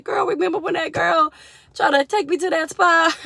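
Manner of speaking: put-on high, loud girl-talk to her mama; animated retelling. Retelling to her mama, high, loud and animated. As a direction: pitch it way up like gossiping with mama.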